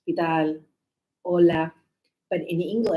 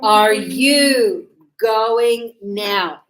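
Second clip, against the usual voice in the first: Are you going now spoken very slowly and louder, each word separate; 7 dB louder, much slower than usual. Shown as a demonstration. Teacher modelling the question slowly and loudly to students. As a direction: spell it out slowly for the class. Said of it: "Yes, it's the question".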